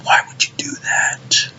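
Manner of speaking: slow, hushed whisper of dismay; despairing whisper. Real, not acted. Fan still lamenting the removed punch, despairing whisper. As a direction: whisper it slowly, drained. Same lament, now unvoiced.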